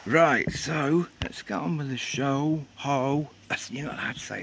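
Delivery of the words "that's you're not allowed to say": ordinary talk, nothing marked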